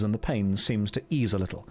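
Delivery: ordinary delivery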